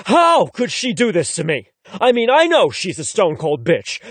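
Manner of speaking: usual speaking voice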